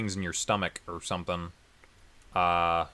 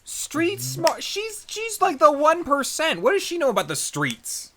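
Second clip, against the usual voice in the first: high, loud scoffing protest; incredulous outrage; 5 dB louder, slower than usual. Real, not acted. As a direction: protest with pitch way up. Mock outraged rebuttal.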